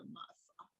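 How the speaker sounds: breathless, fading whisper; a whispered, broken echo; whispered lament. Acted. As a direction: let it die to a whisper of grief. Joseph weeping, fading away.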